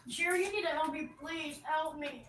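barely audible appeal; hushed plea. Real, not acted. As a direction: beg for help quietly. Quiet appeal to viewer for help.

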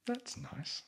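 high, soft sarcastic remark; ironic aside. Real, not acted. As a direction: say it with mock sweetness.

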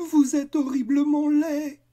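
horrified shout. Acted. Children horrified at the monster.

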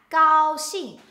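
高兴 said loud, with a high level first tone and a sharp falling fourth tone; tone demo. Shown as a demonstration. Teacher models 高兴 for beginners: high level gao, sharp falling xing. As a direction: give 高兴 a steady high gao, then drop xing hard and loud. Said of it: "first tone and fourth tone".

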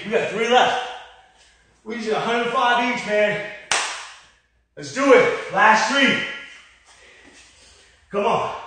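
ordinary talk

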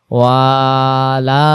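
ওয়ালাদা with every vowel drawn out; over-stretched reading. Shown as a demonstration. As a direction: drag each syllable of ওয়ালাদা. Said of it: "সব জায়গায় টান দেয়".

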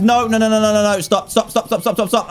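frantic string of no's and stops; panicked no and stop. Real, not acted. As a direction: beg the game to stop, panicking. Drill stuck in patient's lung.

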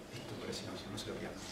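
hushed aside to the audience; confidential whisper. Real, not acted. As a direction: lean in and whisper it as a secret tip. Aparte íntimo al público. (susurro confidencial al público)

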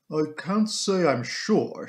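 slow, drawn-out housekeeper voice; composed housekeeper. Acted. Housekeeper asked about the dagger, composed and slow. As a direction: stretch it out deliberately in a housekeeper's character voice.

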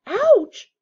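high loud yelp of pain; boy hit on the head, yelping. A narrator's reading. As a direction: yelp as something lands on you. Cat lands on Ron's head.

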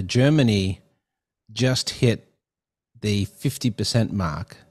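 ordinary talk, nothing marked

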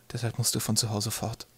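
soft, halting statement; quiet hard news. Acted. Mother telling Twig to leave, quietly. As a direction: get it out quietly after a pause.

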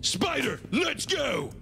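shouted high call to crew. Acted. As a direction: yell it across the house. Calling out to crew.

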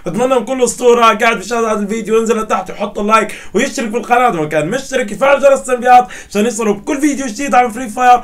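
loud, high, energetic YouTuber opening; hyped channel intro. Acted. YouTuber greeting his fans, asking for likes, loud and hyped. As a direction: pump up the viewers, big and bright.

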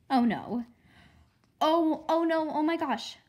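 loud, high scared exclamation; frightened cry. A narrator's reading. Girl spying on the witch crying out in fright. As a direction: cry out in alarm, pitch jumping up.

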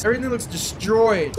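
ordinary talk, nothing marked

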